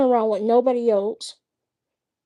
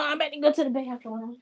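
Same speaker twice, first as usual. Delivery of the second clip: softer and faster, tossed off; quiet quick aside; 2 semitones higher, 7 dB quieter, faster than usual. Real, not acted. Stepping away from the camera, a soft quick aside. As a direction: mumble it quickly as you leave.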